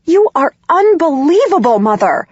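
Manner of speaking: high, loud yell. Acted. Daughter yelling at her mother over the phone. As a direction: let it burst out at her. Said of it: "I yell at her".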